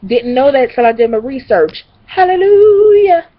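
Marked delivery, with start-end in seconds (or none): 2.1-3.3 loud, high, slow praise; shouted hallelujah. Real, not acted. Celebrating feeling better without dairy. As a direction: sing it out like church.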